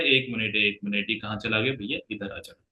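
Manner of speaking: low, soft aside while searching; absent self-talk. Real, not acted. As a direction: trail down quietly, talking to yourself. Searching for lost slide, self-talk. (खुद से धीमी, दबी आवाज़ में)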